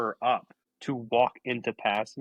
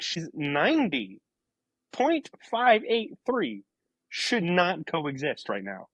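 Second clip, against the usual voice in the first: retold incredulity, slow and emphatic; slow disbelief; 5 semitones higher, slower than usual. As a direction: spell out the impossible, word by slow word. Retelling own disbelief at the figure.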